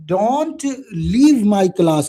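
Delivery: very slow, word by word for notes; slow dictating teacher; slow dictation. Shown as a demonstration. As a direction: spell it out, then say it slowly. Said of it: "तीसरा एक और जुमला".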